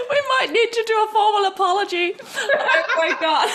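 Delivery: high, helpless laughter through the words; laughing hard. Real, not acted. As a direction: barely get it out for laughing. Cracking up over the accent apology.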